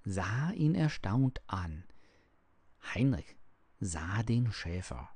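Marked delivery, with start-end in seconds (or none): none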